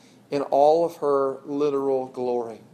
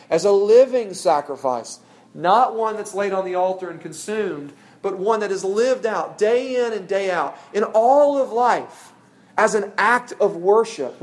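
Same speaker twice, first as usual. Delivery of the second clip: louder, higher preaching of the verse; preaching scripture; 5 semitones higher, 5 dB louder than usual. Acted. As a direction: lift the verse up with full conviction. Continues Romans reading, intensifying.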